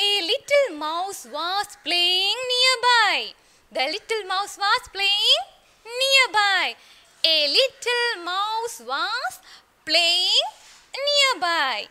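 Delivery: loud, very slow sing-song recital; loud slow story drill. Shown as a demonstration. Teacher chanting the story line to her class, loud and slow. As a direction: stretch every word so the children can follow.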